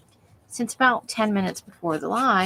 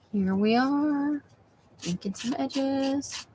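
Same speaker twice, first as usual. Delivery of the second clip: slow, soft, hushed narration of the inking; soft ASMR voice; 5 semitones higher, 3 dB quieter, much slower than usual. Pretend. Crafter murmurs to viewers while inking, slow and soft. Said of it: "that was very A S M R".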